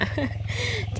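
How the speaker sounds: sudden high-pitched giggle; high laugh. Real, not acted. Mahout laughing at the wandering calf, high giggle.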